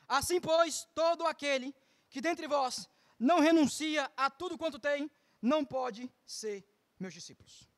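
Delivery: soft, reverent reading of Christ's words; scripture reading. Acted. Preacher quoting Jesus to the church, softly reverent. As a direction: read the verse quietly, with reverence.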